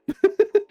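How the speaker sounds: quick high laugh. Real, not acted. Laughing at his own nudes joke, quick high laugh.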